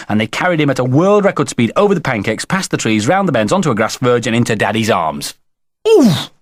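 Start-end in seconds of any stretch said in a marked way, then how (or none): 5.9-6.3 high, loud oof on impact; sudden puffed-out exclamation; winded oof. A narrator's reading. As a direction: grunt as someone bumps into you. Boy runs into Daddy's arms.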